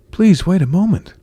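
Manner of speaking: high, loud, beseeching prince; desperate storybook appeal; urgent plea. A narrator's reading. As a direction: call after someone running away. Prince begs Cinderella to wait.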